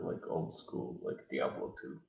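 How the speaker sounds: ordinary talk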